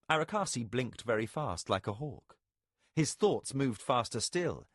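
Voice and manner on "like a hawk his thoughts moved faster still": ordinary delivery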